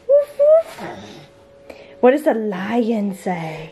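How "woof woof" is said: high laughing dog bark; playful woof. Retold or imitated. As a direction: bark like a puppy for a toddler. Mom imitates dog, laughing.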